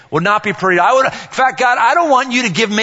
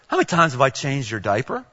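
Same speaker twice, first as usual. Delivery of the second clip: softer mock question; 6 semitones lower, 6 dB quieter than usual. Dad asking daughter quietly.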